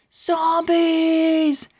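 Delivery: high shouted chant; crowd shout. Acted. Crowd chanting back to the bookstore lady, high and shouting. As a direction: yell it like a whole crowd. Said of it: "Everybody screamed at the top of their lungs".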